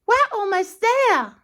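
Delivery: very high, bright call to wake him; Dilly the barge. Acted. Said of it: "Dilly called".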